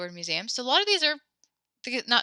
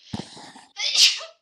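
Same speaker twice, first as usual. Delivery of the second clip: sharp cough; 7 dB louder than usual. Real, not acted. Sneeze coming, cough.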